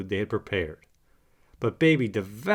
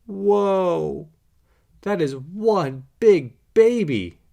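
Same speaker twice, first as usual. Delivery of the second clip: high, loud and slow, staring up in amazement; awed surprise; 7 semitones higher, 5 dB louder, much slower than usual. A narrator's reading. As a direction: stretch it out, gobsmacked. Puppy sees giant Great Dane.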